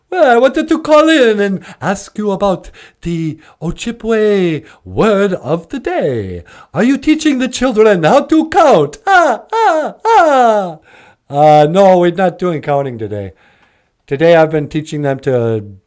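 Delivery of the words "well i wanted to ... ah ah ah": high, swooping Transylvanian vampire voice; grand puppet vampire ending in a staccato cackle; Count Von Count. Acted. Count asking about teaching children to count, hammy vampire voice. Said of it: "Count Von Count".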